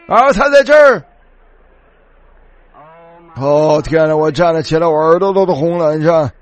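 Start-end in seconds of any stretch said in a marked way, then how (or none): 0.1-1.0 high-pitched call across the hall. Retold or imitated. Man pointing out the hiding preacher to everyone, calling out high. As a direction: re-enact a man's high call to the crowd. 再次模仿旁人喊叫.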